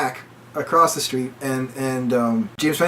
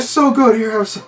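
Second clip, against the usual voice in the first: gleeful; 6 semitones higher than usual. Retold or imitated. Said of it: "Seth's just like".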